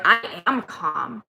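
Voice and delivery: slow, strained imitation of composure; acted-out through-the-teeth calm; mock forced calm. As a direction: insist slowly, barely holding it together. Retold retort to calm down.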